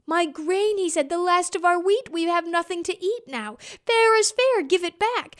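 high, loud insistence; boy insisting the wind return his wheat; boy pleading loudly. A narrator's reading. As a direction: fair is fair, demand it.

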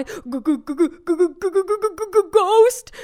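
terrified cry. A narrator's reading. Man at the register sees the ghost, terrified stammering cry. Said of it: "cried the man".

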